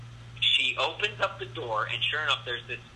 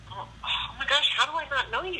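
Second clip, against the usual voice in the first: very high mock-girly dismay; astonished girl; 14 semitones higher than usual. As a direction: imitate a girl shocked at forgetting someone. Imitating Jenny's astonished apology.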